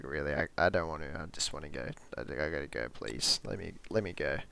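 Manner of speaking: low, soft and defeated, imitating C; begging to be let go, voice dropped low; dejected plea. C pleads quietly with Sonny to let him leave. C begs after ruined date.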